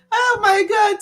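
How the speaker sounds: loud high oh my God; jolted by a sudden thought; surprised shout. Real, not acted. Remembering work tomorrow with a loud cry.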